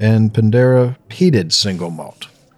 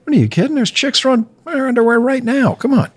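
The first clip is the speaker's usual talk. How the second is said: high, excited, gushing; excited high raving; 13 semitones higher than usual. Real, not acted. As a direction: rave about him up high. Excited raving about [name].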